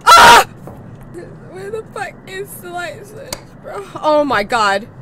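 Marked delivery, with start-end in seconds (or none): 0.1-0.5 scream. Real, not acted. Jump scare.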